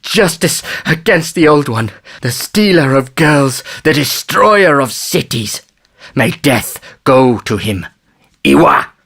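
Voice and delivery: slow, high, impassioned denunciation; rage and fear. A narrator's reading. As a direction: cry out a curse on the wrongdoer, drawn out. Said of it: "his voice trembling with the rage and fear".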